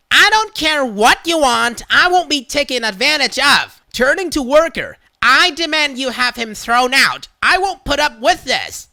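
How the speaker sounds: high, loud entitled tirade; demanding Karen. Retold or imitated. Karen demanding the worker throw him out, loud entitled tirade. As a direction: demand it like you own the store.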